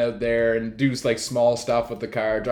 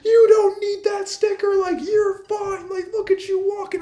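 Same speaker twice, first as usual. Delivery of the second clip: mock angry stranger. Stranger accusing a teen over handicap sticker, squeaky mock rant. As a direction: whine it like a self-righteous busybody. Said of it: "He's like".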